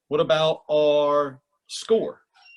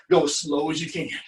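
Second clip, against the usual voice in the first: normal talking voice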